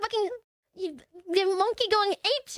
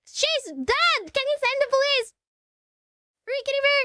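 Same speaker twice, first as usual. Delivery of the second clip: raised-pitch imitation of a distraught caller; mock exasperated caller; 4 semitones higher than usual. Caller pleading with a useless operator, mock exasperated. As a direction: play frantic disbelief, pitched up.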